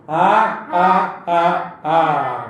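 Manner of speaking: slow, acted "ha ha" laugh; deliberate storyteller's mock laugh; group laughter. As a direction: imitate the crowd with spaced-out ha's. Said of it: "હસવા લાગ્યા".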